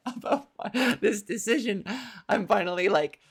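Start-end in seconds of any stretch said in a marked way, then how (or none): 0.0-1.8 laughing. Real, not acted. Laughs while telling the awkward moment.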